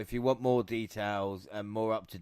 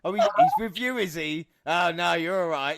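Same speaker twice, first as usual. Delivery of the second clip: much higher, louder mock politeness; hammed-up nervous brush-off; backing off; 5 semitones higher, 4 dB louder than usual. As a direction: recoil with fake courtesy. Star backs off from sweaty fan.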